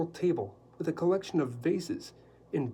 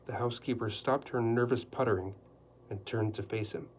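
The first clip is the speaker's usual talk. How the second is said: ordinary delivery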